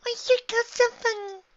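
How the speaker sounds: put-on very high, childlike voice; little filly. A narrator's reading. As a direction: squeak it like a curious child. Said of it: "The little filly said".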